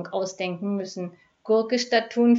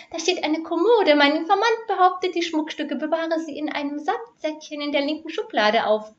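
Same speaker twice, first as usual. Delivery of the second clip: a much higher, piping falsetto; falsetto squeak; 8 semitones higher, 4 dB louder than usual. A narrator's reading. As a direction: squeak it out like a nervous little crook. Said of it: "piepste die Fistelstimme". (hohe piepsige Fistelstimme)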